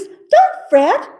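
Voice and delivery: high, loud squeaky mouse voice; a little mouse comforting the bear; mouse squeak. Acted. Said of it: "Mouse squeaks".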